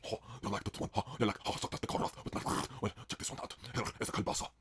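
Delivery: low, soft, husky showing off; Isla. Acted. Isla teasing the crowd with sausages, low murmur. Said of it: "it's Isla's deep blow sausage zucchini party".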